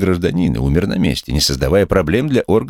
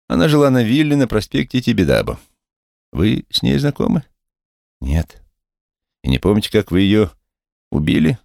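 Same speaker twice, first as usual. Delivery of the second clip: high accusing interrogator; high officer voice; 6 semitones higher than usual. Acted. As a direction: press him, pitched high.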